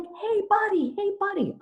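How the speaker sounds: high-pitched call after the driver; yelling after him. Retold or imitated. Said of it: "I'm yelling at this man".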